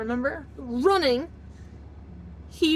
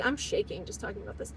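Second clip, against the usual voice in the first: real, much softer aside; close to tears; 7 semitones lower, 11 dB quieter than usual. Real, not acted. Telling a painful story, shaken and near tears. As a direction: drop low and quiet, near tears. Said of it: "That's gonna make me cry".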